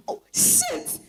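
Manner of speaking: whispered curse in alarm; panicked whisper. Acted. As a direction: hiss it under your breath, caught out. Caught in bed, car arriving.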